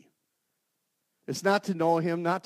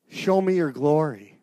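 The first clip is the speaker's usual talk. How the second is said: slow, louder insistent cry; loud plea; 11 dB louder than usual. Acted. Moses crying out to God for his glory. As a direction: lean into it and call out slowly. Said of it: "show me your glory (repeat)".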